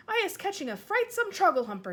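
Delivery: answering Sophie in despair; distressed cry. Acted. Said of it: "he cried".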